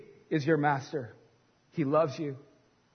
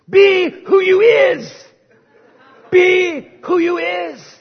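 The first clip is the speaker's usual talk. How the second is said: put-on Southern preacher accent; 13 semitones higher, 12 dB louder, much slower than usual. Preacher imitating the African preacher from the US South, a loud slow Southern preacher shout. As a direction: boom it like a Southern preacher at the climax, badly imitated. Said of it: "I'll try to say it in his accent".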